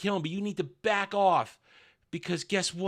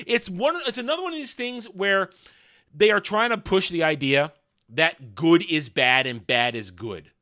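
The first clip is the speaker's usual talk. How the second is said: usual speaking voice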